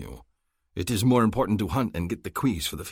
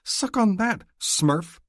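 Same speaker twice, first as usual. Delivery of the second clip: gloating taunt; 14 semitones higher, 5 dB louder, slower than usual. A narrator's reading. Gloating at Hassan.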